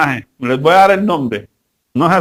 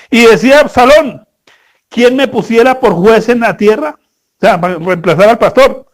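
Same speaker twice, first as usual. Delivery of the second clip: louder, measured Bible reading; scripture reading; 4 semitones higher, 5 dB louder than usual. Acted. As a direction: read the verses out with weight. Reads the Bible passage aloud.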